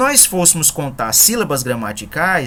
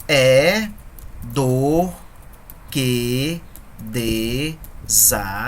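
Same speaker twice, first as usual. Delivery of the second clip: syllables split and drawn out slowly with pauses; scanning the verse; 3 semitones lower, much slower than usual. Shown as a demonstration. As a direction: break the line into syllables, slowly. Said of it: "Vamos treinar no quarto verso a escansão". (escansão silabada e lenta)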